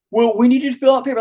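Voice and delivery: high, hurried mock clerk; hospital staff. Staff asking father for paperwork, high hurried voice. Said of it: "they were like".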